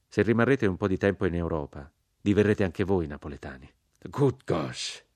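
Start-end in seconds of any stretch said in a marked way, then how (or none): 4.0-5.0 a mild, understated gasp of dismay; dismayed exclamation. A narrator's reading. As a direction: exclaim it as if the blood drains from your face. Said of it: "esclamò il generale Cork impallidendo".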